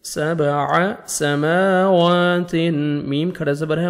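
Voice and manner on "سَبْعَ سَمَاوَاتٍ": سَمَاوَاتٍ slow with long drawn vowels; slow tajweed drill; stretched مد. Shown as a demonstration. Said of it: "one second آپ نے میم کو اور واو کو کھینچنا ہے".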